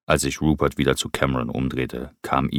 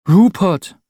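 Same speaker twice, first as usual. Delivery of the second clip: sehr hoch und laut aufgeschreckt gerufen; erschrockener Ruf; 12 dB louder than usual. Acted. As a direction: der Name schießt vor Schreck heraus. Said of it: "rief Cameron erschrocken". (Cameron ruft erschrocken nach Rupert)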